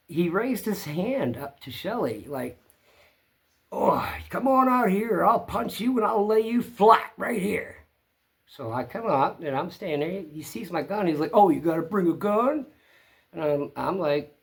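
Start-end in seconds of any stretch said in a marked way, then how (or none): 3.7-7.8 loud, high mock threat; raised, pitched-up voice mimicking an angry bully; imitated angry yelling. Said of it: "yelling at her, calling Shelley". 11.3-12.7 high, loud imitated challenge; raised-voice mimicry of a provocation; mock taunt. Acts out angry neighbor's taunt.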